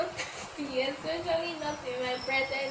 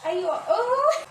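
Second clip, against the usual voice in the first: put-on concerned boyfriend; 8 semitones higher, 5 dB louder, slower than usual. Boyfriend seeing his girlfriend cry, mildly surprised. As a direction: ask gently, startled.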